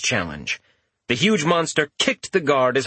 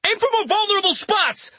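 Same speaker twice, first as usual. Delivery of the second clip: much higher-pitched battle shout; faster than usual. A narrator's reading. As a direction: shout an order across the fight. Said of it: "he shouted to Scion".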